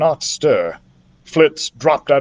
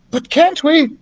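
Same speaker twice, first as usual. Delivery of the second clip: put-on young boy voice; 12 semitones higher than usual. A narrator's reading. Young narrator pleads.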